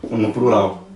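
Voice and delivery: normal talking voice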